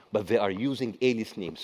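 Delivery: ordinary talk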